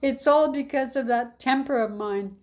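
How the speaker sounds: a hint of a frantic innkeeper; pleading innkeeper. Innkeeper pleading, voiced by narrator.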